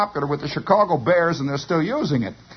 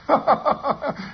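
amused chuckle, pitched up; laugh; 6 semitones higher than usual. Real, not acted. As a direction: chuckle at your own story. Storyteller laughs at his own tale.

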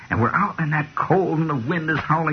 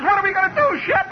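high, frantic question to a friend; imitating scared Doppler, much higher; mock panic. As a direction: panic at runaway speed. Doppler panicking on runaway boat.

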